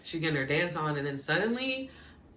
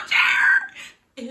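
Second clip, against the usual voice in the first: very high, shrieked outrage; shrieked name; 9 dB louder than usual. Real, not acted. Fan shrieking at the writer, very high. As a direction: shriek his name in disbelief.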